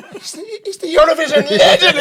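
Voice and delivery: high, loud laughing exclamation; starstruck outburst. Retold or imitated. Player telling English lad who the singer is, excited high shout. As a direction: shout it through laughter, pitch shooting up.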